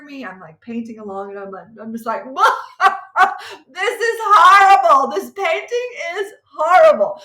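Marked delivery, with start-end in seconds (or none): 2.3-3.6 high, loud laughing burst; cracking up helplessly; laughing at her own ruined painting; burst of laughter. Real, not acted. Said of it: "busting out laughing". 3.7-7.2 loud and high, laughing through it; half-cry, half-laugh over a ruined painting; laughing at how bad the painting looks; laughing despair. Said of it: "busting out laughing".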